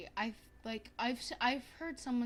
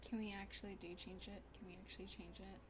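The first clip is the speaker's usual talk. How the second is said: much softer, to herself; muttered; 4 semitones lower, 13 dB quieter, faster than usual. Real, not acted. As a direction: mutter it under your breath. Muttered to self.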